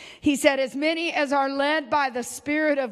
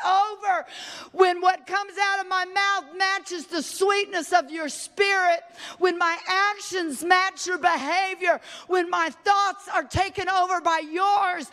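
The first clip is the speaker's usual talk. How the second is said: high, impassioned cry to God; anguished prayer; 5 semitones higher than usual. Acted. Believer battling the flesh cries to God, anguished.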